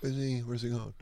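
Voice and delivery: quiet anxious mumble in the back seat; muttered worry. Shown as a demonstration. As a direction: mutter it to yourself, unsure of the route. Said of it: "I'll go like this".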